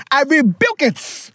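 slow, put-on preacher voice, lightly done; mock preacher rebuke. Rebuking the letter writer like a preacher, slow mock sermon. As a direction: play a preacher casting it out, half joking.

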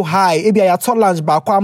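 usual speaking voice

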